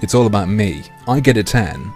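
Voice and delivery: ordinary delivery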